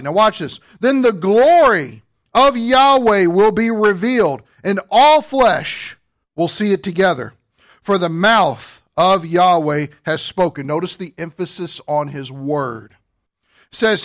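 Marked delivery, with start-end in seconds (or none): 0.8-10.7 slow, weighty Bible reading; scripture proclamation. Acted. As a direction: proclaim the verse, stretching the key words. Reads scripture aloud forcefully.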